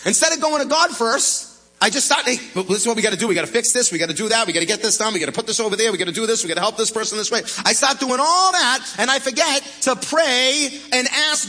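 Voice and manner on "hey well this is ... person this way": rapid-fire list, lower than the preaching; acted-out rush of a compulsive fixer; hurried fixing. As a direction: rattle off chores in a busy rush. Acting out his frantic rush to fix.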